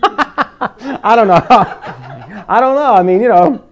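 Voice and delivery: loud, high laughter through the words; laughing. Real, not acted. Preacher laughing at his own tooth fairy joke, laughing out loud. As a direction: crack up at your own joke.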